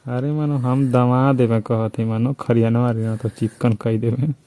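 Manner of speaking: usual speaking voice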